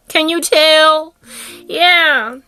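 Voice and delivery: high, loud, stretched-out question; emphatic repeat. Acted. Speaker pressing the audience to see it, emphatic repeat. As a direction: sing out the last word, slowly.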